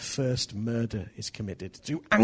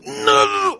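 long, high, hammed-up yell; angry outburst; 8 dB louder than usual. Husband's third refusal at tea time, exploding angrily. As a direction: draw it out in an acted roar.

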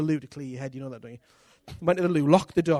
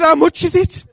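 higher, much louder shocked cry; 11 semitones higher, 15 dB louder than usual. Retold or imitated. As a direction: blurt it out in shock, loud and high. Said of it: "I was so shocked".

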